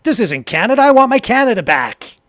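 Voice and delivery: loud, high, indignant outcry; alarmed protesters. Retold or imitated. Host voicing marching protesters, loud and indignant. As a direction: voice the marchers' outrage loudly. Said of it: "And they're like".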